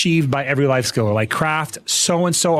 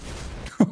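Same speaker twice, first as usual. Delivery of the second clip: quiet breathy chuckle; 7 dB quieter than usual. Real, not acted. Soft laugh after joke.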